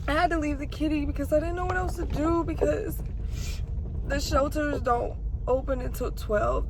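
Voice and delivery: apologizing through rising tears; near tears. Real, not acted. Vlogger explaining she had to leave the kitty, near tears. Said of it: "I'm trying not to cry".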